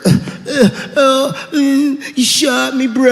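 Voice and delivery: groggy, stunned dying voice; dying addict voice. Acted. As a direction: play the dying junkie. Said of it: "saying stupid heroin last words".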